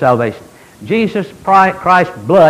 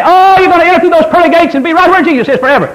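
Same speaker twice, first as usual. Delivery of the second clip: high, sing-out mock rapture; exaggerated, high-pitched pulpit sweetness; mock gushing; 10 semitones higher, 4 dB louder, faster than usual. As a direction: gush it like an overjoyed false promise. Voices preachers' rosy promises.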